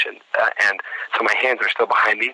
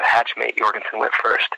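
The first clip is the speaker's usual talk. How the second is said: usual speaking voice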